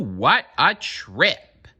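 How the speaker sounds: usual speaking voice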